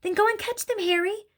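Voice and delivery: high-pitched, voiced nagging hiss; urgent hiss. A narrator's reading. Mother sends her husband after burglars, hissing urgently. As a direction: order him high and urgently. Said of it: "hissed the mother".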